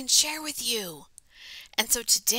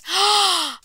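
sharp gasp; dramatic gasp; 9 semitones higher, 7 dB louder than usual. Acted. Kitten gasping after his speech. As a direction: gasp in surprise.

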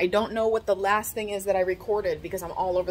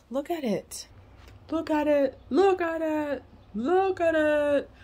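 building excitement; 6 semitones higher, slower than usual. Real, not acted. Showing off new curtain, escalating.

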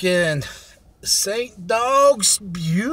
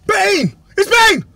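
excited shout; 11 semitones higher, 4 dB louder, slower than usual. Retold or imitated. Recognizing an artist on track.

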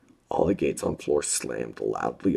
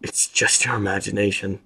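plain reading voice

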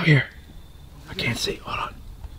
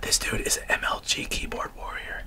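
whispering; 5 semitones higher, 6 dB quieter than usual. Real, not acted. Still sneaking, whispering.

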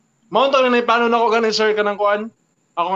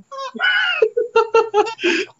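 shrieking laugh; 14 semitones higher than usual. Real, not acted. Laughing at own impression.

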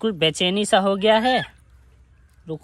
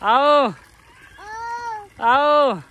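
calling out; 5 semitones higher, slower than usual. Real, not acted. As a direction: call them over across the yard. Calling and directing monkeys. (बंदरों को ज़ोर से बुलाना)

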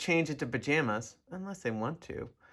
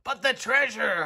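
anguished holler; 7 semitones higher than usual. A narrator's reading. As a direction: holler for the lost treasure. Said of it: "hollowed Braidbeard".

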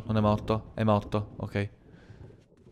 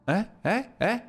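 ordinary delivery

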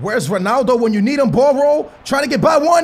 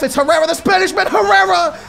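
fast excited goal call; 9 semitones higher than usual. Real, not acted. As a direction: rattle off the goal in a rush. Second goal scored.

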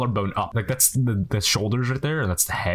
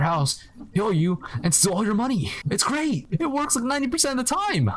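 much higher, jokey boast; mock villain glee; 12 semitones higher than usual. Cleaner to homeowners, high mock villain boast. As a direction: brag like a cartoon crook. Joking about robbing the owners.

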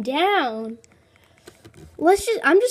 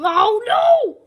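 loud, high cry of dismay; sudden loud oh no; shocked shout; 8 semitones higher, 8 dB louder than usual. Real, not acted. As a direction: blurt it out in alarm. Sudden setback in game.

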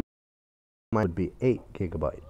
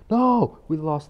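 sudden high, loud cry; dismayed shout; 6 semitones higher, 6 dB louder, slower than usual. Real, not acted. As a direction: yell in panic as they leave. Customer lost.

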